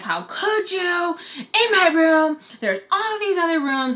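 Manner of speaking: high, slow mock accusation; fighting. Wife confronting cheating husband, high slow outrage. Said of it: "like, fighting".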